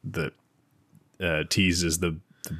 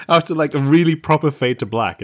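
high and louder, half laughing; amused disbelief, pitched up; laughing exasperation; 7 semitones higher, 7 dB louder than usual. Real, not acted. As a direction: push it out through laughter.

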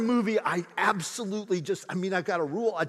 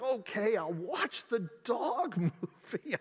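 giving in, laughing; 7 semitones higher, 4 dB quieter, slower than usual. Retold or imitated. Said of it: "And I'm like".

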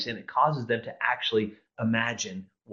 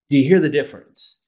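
ordinary talk, nothing marked

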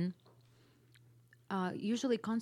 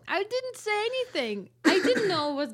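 defensive high protest; 10 semitones higher, 13 dB louder than usual. Real, not acted. Defensive reply to lie accusation.

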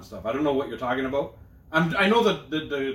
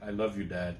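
low, quiet, deflated imitation; reluctant mumbled reply; 7 semitones lower, 7 dB quieter than usual. Son made to say it back to dad, reluctant. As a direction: say it small and low, giving in.

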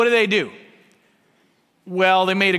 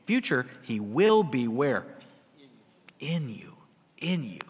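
normal talking voice

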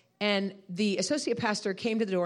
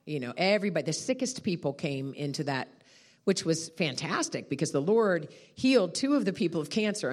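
usual speaking voice

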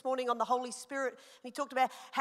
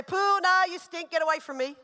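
higher, louder mock yuck from a little boy; disgusted child; 5 semitones higher, 5 dB louder than usual. Son tells his sweaty mum to get away, higher and louder. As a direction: push mum away like a grossed-out kid. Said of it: "and he'll say".

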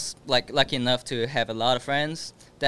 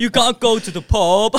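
higher, louder jokey British voice; hammed-up British scolding; mock British accent; 9 semitones higher, 7 dB louder than usual. Joining the bit, loud mock British accent. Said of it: "it sounded like".

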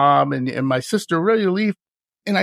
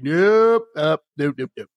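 mock refusal. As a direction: playfully shut the request down, fading out. Retells refusing to be suckered in.

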